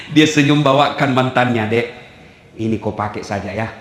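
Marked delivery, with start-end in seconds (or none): 1.7-3.7 low, soft sweet-talk; tender coaxing. Acted. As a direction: murmur it low to an old flame. Husband gives dead wife's gold to sweetheart. (merayu pelan dan lembut)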